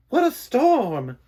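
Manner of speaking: very high, loud, slow bird voice; chirpy little bird exclaiming; twittering wren. Acted. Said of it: "twitters wren".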